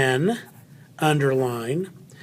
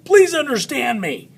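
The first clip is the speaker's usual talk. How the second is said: high, earnest mock plea; acted plea; 9 semitones higher, 3 dB louder than usual. Teacher voicing what everyone says when speaking, high mock plea. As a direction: plead in a raised, put-on voice. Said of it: "We're always saying".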